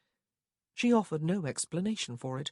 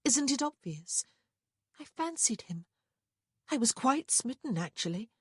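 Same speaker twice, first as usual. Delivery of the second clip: higher-voiced young sister confessing; Prudence, defiant; 7 semitones higher, 4 dB louder than usual. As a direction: explain yourself insistently, pitched up.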